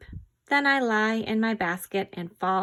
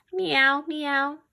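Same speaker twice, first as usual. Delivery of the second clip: high meowing; high cat mews; 6 semitones higher, 3 dB louder than usual. Shown as a demonstration. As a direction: meow like a kitten. Animal sound quiz for kids.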